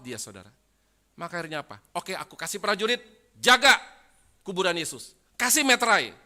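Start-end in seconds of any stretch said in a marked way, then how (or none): 1.9-6.2 loud, high orders from a governor; acted official command, building to a shout. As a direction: order the troops out at full volume. Acts Pilate giving orders.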